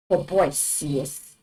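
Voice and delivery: ordinary talk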